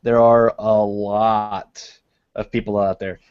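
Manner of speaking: normal talking voice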